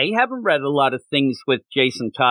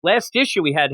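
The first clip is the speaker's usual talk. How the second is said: ordinary talk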